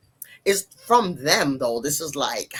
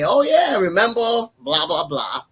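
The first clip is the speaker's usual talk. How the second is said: imitating teasing kids, high and loud at first; joking; 7 semitones higher, 4 dB louder than usual. Kids joking with mom about her stories, imitated teasing. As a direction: start with a high teasing burst, then ease off. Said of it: "they'll joke with me".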